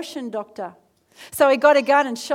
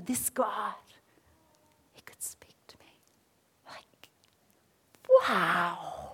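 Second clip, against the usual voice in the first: breath-soft wonder, almost voiceless; acted-out hushed awe, barely audible; awed hush; 3 semitones lower, 8 dB quieter, slower than usual. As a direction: drop to an amazed whisper, with pauses. Acting out awed private thought.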